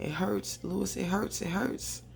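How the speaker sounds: a light, high imitation of pained crying; mock crying. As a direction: whimper it hurts in a half-hearted sob. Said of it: "Teddy starts crying and saying".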